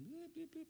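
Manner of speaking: high, soft mock censor bleeps; mock bleeped swearing. Preacher mimicking bleeped curses after hitting a thumb, high and soft. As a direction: pretend to bleep out your own swearing. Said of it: "hitting your thumb and going".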